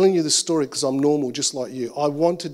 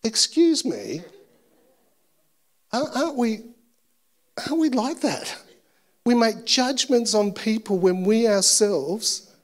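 Holy Spirit rebuking; 6 semitones higher than usual. Retold or imitated. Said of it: "the Holy Spirit's, like, slapping me, going".

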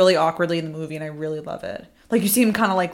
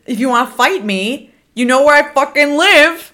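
mock challenge; 8 semitones higher, 8 dB louder than usual. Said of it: "I said it".

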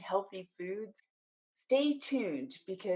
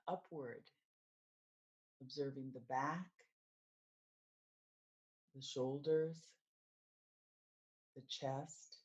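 guided meditation; 2 semitones lower, 4 dB quieter than usual. Acted. Coach leading listeners through mindfulness of the body, guided meditation. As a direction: guide them gently through the body, unhurried, settling softer.

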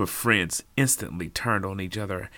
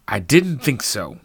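smug, slow put-down; conceited; 3 semitones higher, 7 dB louder than usual. Acted. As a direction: gloat over the silence. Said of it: "the detective said conceitedly".

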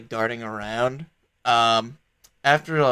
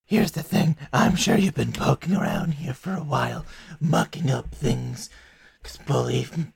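a silky, bargaining fiend, lightly voiced; a chained devil bargaining with the party, silky; smooth devil. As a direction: sell the deal like a charming devil.

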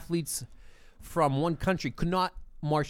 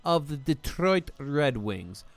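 fake Russian accent; 5 semitones higher, 4 dB louder than usual. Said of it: "What accent is this, Mike?".

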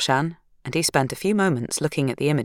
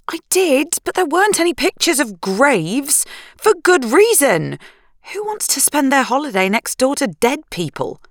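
higher, tense wife's voice; anxious young woman; 11 semitones higher, 3 dB louder than usual. Acted. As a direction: voice her as high-strung and rattled. Narrator voicing Sarah.

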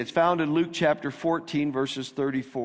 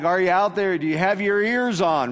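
louder, higher mock radio call; 5 semitones higher, 4 dB louder than usual. Pastor imitating an old CB radio call, mock loud call. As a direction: call out like a trucker on the CB. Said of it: "that was a C B call".

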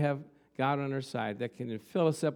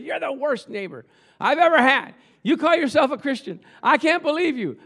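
higher and louder, indignant shout; angry yelling; 8 semitones higher, 7 dB louder than usual. Retold or imitated. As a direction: let her rip into him. Neighbor yelling at speaker.

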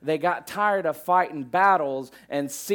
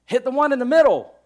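much higher, much louder, fast hammed-up corner-man yell; trainer's shout; 12 semitones higher, 12 dB louder, faster than usual. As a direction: bellow the advice like a trainer in the corner. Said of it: "He goes".

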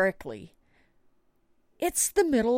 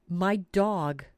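ordinary talk, nothing marked

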